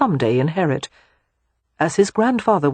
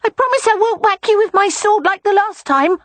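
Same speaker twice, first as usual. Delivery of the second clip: put-on high, louder child's voice, wheedling; a boy luring his aunt outside; coaxing little boy; 5 dB louder than usual. A narrator's reading.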